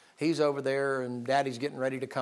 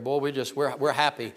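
ordinary delivery

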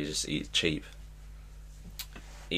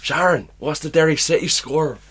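higher, louder [name] call; [name] calling Sharon; 7 semitones higher, 6 dB louder than usual. Retold or imitated. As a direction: shout the question at his wife. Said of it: "He's more".

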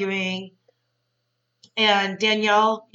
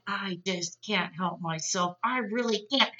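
retold helpless confession; 2 semitones lower, 8 dB quieter than usual. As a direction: act out giving in to temptation, quietly. Imitates Danielle unable to resist.

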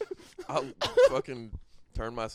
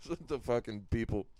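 ordinary talk

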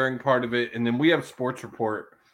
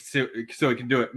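normal talking voice

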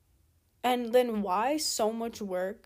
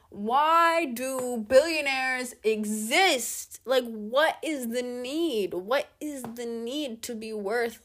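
slow, drawn-out exasperated demand; 3 dB louder, slower than usual. Real, not acted. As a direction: stretch each word in loud disbelief. Exasperated rant about billionaires.

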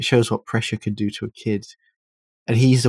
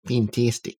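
put-on copycat Australian accent; playfully faked Australian twang; mock Australian accent; 3 dB quieter, slower than usual. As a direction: echo the bad Australian accent. Echoing co-host's fake Australian accent.

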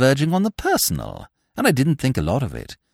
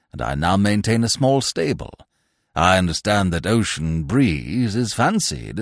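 put-on pompous old lord; 8 semitones lower than usual. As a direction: speak down from great dignity, deep and measured. Pompous peer speaks.

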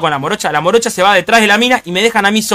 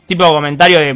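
normal talking voice

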